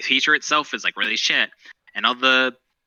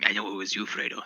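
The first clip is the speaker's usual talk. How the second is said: Godfather quote; 3 semitones lower, 9 dB quieter than usual. Retold or imitated. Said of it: "cowardly son in Godfather".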